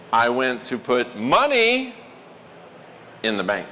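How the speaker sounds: emphasis demo with money standing out; stress on money. Shown as a demonstration. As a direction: hit the word money, drop low after it. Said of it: "punch words for emphasis".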